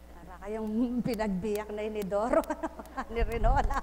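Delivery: laughing through the joke; amused laughing delivery. Real, not acted. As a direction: chuckle out the punchline.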